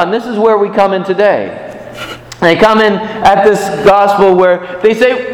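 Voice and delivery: ordinary talk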